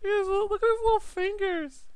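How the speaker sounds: very high falsetto squeak; playful cooing. Pretend. Painter admiring robot's little fingers, falsetto cooing. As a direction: coo in a tiny, high put-on voice.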